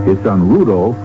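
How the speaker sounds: usual speaking voice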